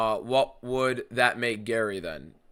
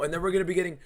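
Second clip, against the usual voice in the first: building into a shout; 7 semitones higher, 6 dB louder, faster than usual. Real, not acted. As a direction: pitch climbs higher, ending in a loud shout. Rising rant about Gary montages.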